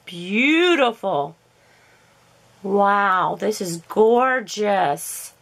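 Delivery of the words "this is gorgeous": ordinary delivery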